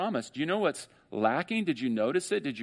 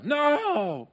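crowd's refusal; 13 semitones higher than usual. Retold or imitated.

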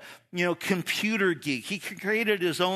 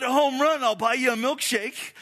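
louder, higher cheer from behind the plate; yelling out; 6 semitones higher, 4 dB louder than usual. Retold or imitated. Mom yelling to her son at bat, loud and fast. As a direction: call it out to the batter. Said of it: "she'd yell out".

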